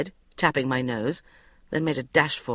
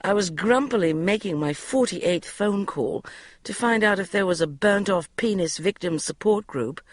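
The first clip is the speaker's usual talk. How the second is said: ordinary delivery, nothing marked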